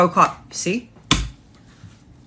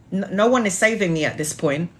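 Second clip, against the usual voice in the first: normal talking voice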